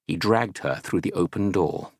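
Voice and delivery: ordinary delivery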